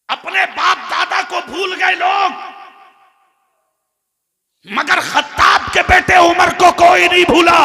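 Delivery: impassioned preaching. Real, not acted. Preacher telling the crowd nobody forgot Umar, impassioned. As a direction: build to a ringing shout.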